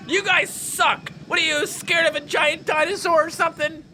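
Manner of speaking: high-pitched, taunting outburst; mock jeering. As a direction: heckle the cowards, drawing out the insult. Jeers at fleeing park visitors.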